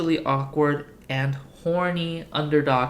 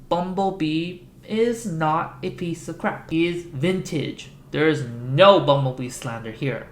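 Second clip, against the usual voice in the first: ordinary talk, nothing marked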